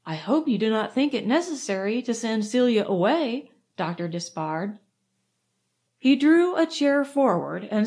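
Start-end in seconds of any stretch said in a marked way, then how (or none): none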